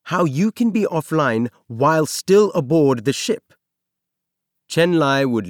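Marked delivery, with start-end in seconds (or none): 0.0-3.5 a hint of a stern old engineer, higher and pointed; lightly voiced stern engineer demanding an answer. A narrator's reading. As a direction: press the question like a strict superior. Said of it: "the engineer would demand".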